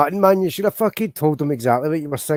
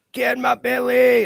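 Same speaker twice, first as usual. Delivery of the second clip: much higher, loud, slow greedy Scottish growl on belly; Fat Bastard impression; 10 semitones higher, 4 dB louder than usual. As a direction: bellow the catchphrase at a friend munching a burger. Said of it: "That's one for Dan".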